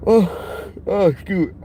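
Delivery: real yawn stretching the words. Real, not acted. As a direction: yawn as you apologise. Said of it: "I'm sorry I'm yawning".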